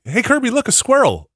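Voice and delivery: put-on high, light child's voice urging the dog; imitating daughter. Daughter pointing out a squirrel to the dog, in a high child's voice. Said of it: "my daughter today was like".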